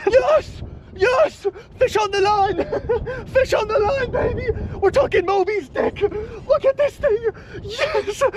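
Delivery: high, thrilled cries of joy; excited shouting. Real, not acted. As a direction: shout it out, overjoyed. Fish finally bites after hours.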